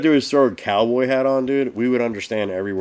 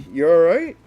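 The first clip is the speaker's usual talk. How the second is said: checking on passenger; 5 semitones higher, 5 dB louder than usual. Retold or imitated. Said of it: "I'm just like".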